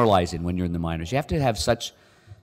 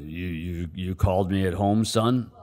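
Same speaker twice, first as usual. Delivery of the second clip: distinct GM voice; slower than usual. Retold or imitated. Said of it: "he's very distinct the way he talks".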